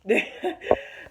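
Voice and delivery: a short breathy laugh through tears; tearful laugh. Real, not acted. Tearful laugh while telling viewers about the breakup. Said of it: "I'm trying not to cry".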